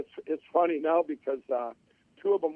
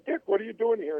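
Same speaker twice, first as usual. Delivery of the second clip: surprised; 7 semitones higher than usual. Retold or imitated. Surprised friend walks in unannounced.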